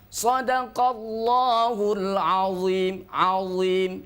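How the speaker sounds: slow chanted Quran recitation. Shown as a demonstration. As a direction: recite it slowly, stretching the vowels. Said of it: "sifat yang senantiasa harus kita jaga".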